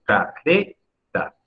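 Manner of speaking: careta drawn out slowly, weight on RE; stress on the middle syllable. Shown as a demonstration. Teacher models careta for students, slow syllable stress. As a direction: stretch careta syllable by syllable, leaning on RE. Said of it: "No se dice careta o careta".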